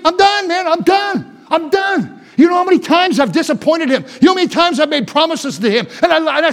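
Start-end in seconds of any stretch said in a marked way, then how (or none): 0.0-2.2 exasperated high cry of giving up; fed-up Jesus. Pretend. Preacher voicing Jesus giving up, exasperated. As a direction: throw up your hands, mock exasperated.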